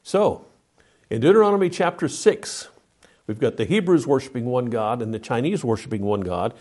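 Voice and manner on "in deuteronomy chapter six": ordinary delivery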